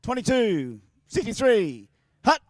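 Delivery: slow, drawn-out snap count; mock quarterback calling the snap. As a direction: call the play like a quarterback. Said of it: "quarterback time".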